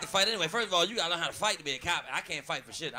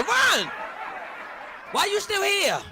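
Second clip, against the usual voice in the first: mock panicked shout; 11 semitones higher, much slower than usual. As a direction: yell at himself in panic, laughing. Shouting at himself, laughing.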